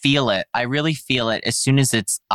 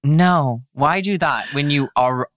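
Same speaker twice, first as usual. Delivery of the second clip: usual speaking voice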